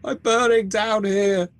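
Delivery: high, loud, desperate pleading; pleading burning wife. Acted. The wife's voice begs Charlie for help, high and anguished.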